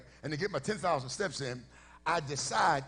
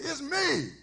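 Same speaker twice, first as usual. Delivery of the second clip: high-pitched woman; 8 semitones higher than usual. Retold or imitated. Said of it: "this high-pitched voice".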